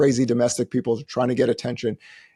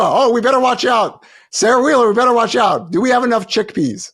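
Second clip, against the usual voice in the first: mock alarm; 9 semitones higher, 5 dB louder than usual.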